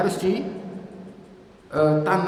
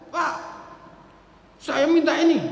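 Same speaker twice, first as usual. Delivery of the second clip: mock pushy child, slightly raised; snappy demand; 5 semitones higher than usual. Child demanding something from father, mildly snappy. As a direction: pitch it up a little, demanding. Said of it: "Kita ngegas".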